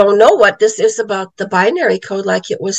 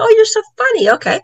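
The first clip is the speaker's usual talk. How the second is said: sarcastic retort; 13 semitones higher, faster than usual. Retold or imitated. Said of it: "And I go".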